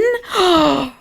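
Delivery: high, drawn gasp; mock gasp. Reader feigning shock at jealousy from men, mock gasp.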